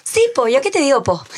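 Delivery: high, loud hammed-up Chilean accent; mock Chilean with po. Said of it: "Dime algo con acento chileno". (acento chileno exagerado, agudo y fuerte)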